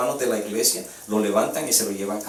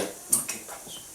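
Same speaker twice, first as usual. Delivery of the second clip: hushed calm reply; 17 dB quieter, much slower than usual. Retold or imitated. Calm widow answers.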